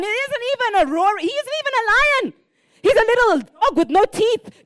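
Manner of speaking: loud, rising preacher's proclamation; forceful preaching. Real, not acted. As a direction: preach it with full conviction.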